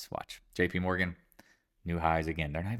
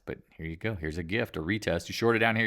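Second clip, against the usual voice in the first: ordinary talk, nothing marked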